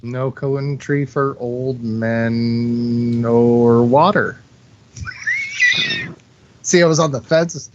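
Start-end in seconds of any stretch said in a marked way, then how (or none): 0.0-4.4 drawn out. Real, not acted. Torn between two films.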